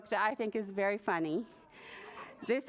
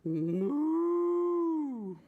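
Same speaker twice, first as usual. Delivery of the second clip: long, raised cow moo; shouted moo; 4 semitones higher than usual. A narrator's reading. Little bird trying again for his song, mooing out. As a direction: draw out a big imitation moo. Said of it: "so he shouted".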